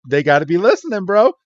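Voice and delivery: normal talking voice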